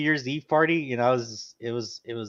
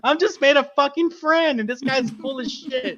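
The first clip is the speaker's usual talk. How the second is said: indignant protest; 10 semitones higher, 5 dB louder than usual. Retold or imitated. Narrator protesting his innocence.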